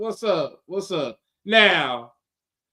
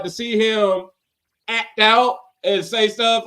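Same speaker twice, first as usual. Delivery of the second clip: normal talking voice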